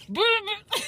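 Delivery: high, buzzing mock vuvuzela blasts; a playful imitation of a horn at a party. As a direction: blow it out like a vuvuzela. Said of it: "all you hear is vuvuzelas".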